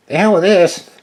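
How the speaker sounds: Buffy throws up her hands and yells, quitting; fed-up outburst. Acted. Buffy bailing on the Efreet fight, a high loud shout.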